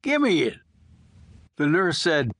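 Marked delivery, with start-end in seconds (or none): 0.0-0.6 sharper demand. Acted. Old prisoner rattling his chain, demanding the smoke, higher and sharper. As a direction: pitch jumps high on a snapped demand.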